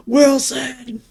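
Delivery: high, drawn-out distant call; castaway calling out. Acted. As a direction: cry out like a stranded castaway. Imitating castaway calling out Wilson.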